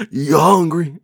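high loud blurt; Cage impression. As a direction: toss off the line high and loud. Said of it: "you don't like mine".

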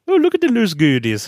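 put-on high, singsong Swedish accent on "loose goodies". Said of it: "mocking Swedish phrase".

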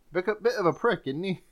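soft second try at British; mock-British, softly, fading at the end; British accent. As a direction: quietly test a put-on British accent. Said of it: "heard British people say prick".

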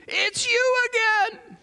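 high, slow, stretched-out happy greeting; delighted waitress. Retold or imitated. The waitress recognising the preacher, high and drawn out with delight. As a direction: sing it out like she's thrilled to see him.

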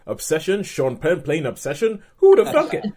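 mock disbelief rising to a high, loud laughing squeal; incredulous. Podcaster replaying own reaction to Sean Penn casting, incredulous. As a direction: build from puzzled questions to loud, laughing incredulity. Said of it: "I was like".

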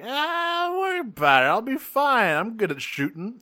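high, breezy, overconfident; high cocky voice. Retold or imitated. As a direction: brush it off like a show-off.

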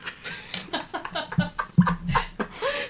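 high, soft, breathless laugh; long, quiet giggling fit; helpless laugh with little voice; laughter. Real, not acted. Wordless laughter after the exchange.

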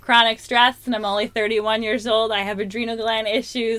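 loud, self-mocking rant; joking self-mockery. Joking to her husband about being a basket case, loud. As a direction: list your woes with comic exaggeration. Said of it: "my joke about it with my husband".

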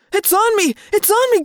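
high, loud terrified yelling; panicked screaming. Acted. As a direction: yell it, frantic with panic. Boy feels rat on his leg.

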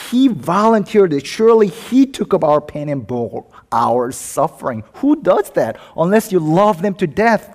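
loud, high-pitched sermon fervour; impassioned preaching. Real, not acted. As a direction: preach it with rising force and urgency. Preaches at full force.

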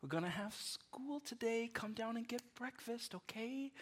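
soft, high, whispered coaxing; gentle parent wake-up voice. Retold or imitated. Parent gently waking a child for school, soft whisper. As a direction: coax a sleeping kid awake in a hush. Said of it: "you're like".

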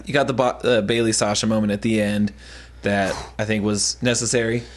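ordinary talk

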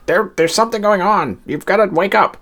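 lightly acted plea to rouse people; urgent broadcast warning. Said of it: "the transmission that's telling people".

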